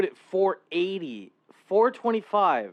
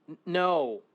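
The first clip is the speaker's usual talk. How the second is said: ordinary talk, nothing marked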